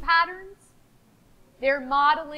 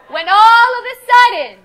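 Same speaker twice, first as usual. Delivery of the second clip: dramatic reveal; 8 dB louder, slower than usual. Acted. Teacher unveils the shark to the class, dramatic. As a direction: build up then slow on the big bad shark.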